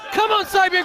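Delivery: urging shout for Sabian; cheering on. Real, not acted. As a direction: call out to spur him on. Cheering on wrestler.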